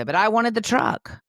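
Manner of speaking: put-on high, loud little-kid complaint; protesting child. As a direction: play a child who wants the toy. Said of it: "John Michael might say".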